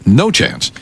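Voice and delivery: ordinary talk, nothing marked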